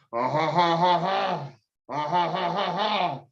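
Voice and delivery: sleazy chuckling French laugh; leering mock chuckle; lecherous Frenchman's chuckle; dirty laugh. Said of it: "dirty French man there".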